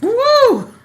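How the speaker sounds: high, loud whoop; a far, squealed woo; excited whoop. Real, not acted. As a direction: cheer her on with a whoop. Host reacts to the sassy retelling.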